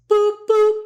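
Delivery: high, loud beep noise; hang-up beeps. Retold or imitated. Hanging up on Andy, high beeping sound. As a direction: make the phone hang-up sound.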